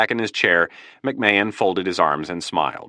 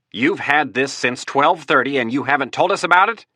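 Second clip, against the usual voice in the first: indignant; 7 semitones higher, 3 dB louder than usual. Acted. Accusing outburst at the table.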